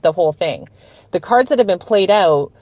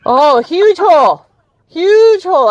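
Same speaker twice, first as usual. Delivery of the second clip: emphatic agreement; 8 semitones higher, 6 dB louder than usual. Real, not acted.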